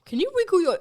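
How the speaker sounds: put-on high, loud eager kid voice; excited kid echo. Echoing the kid's question.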